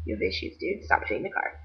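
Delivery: hushed deadpan telling-off; quiet scolding. Retold or imitated. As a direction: mutter it under your breath. She quietly scolds the man.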